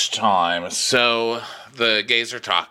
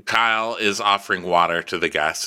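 ordinary talk

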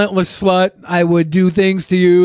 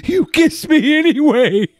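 mocking girl voice; 7 semitones higher, slower than usual. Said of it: "She says".

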